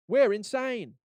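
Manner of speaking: high punchy boast; hype slogan. Retold or imitated. Catalog explosion graphic, mock hype. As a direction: shout it like a banner. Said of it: "it's just like".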